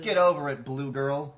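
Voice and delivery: loud, high dismissive taunt; sassy jab. Real, not acted. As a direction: tell her to get over it. Mocking a movie character.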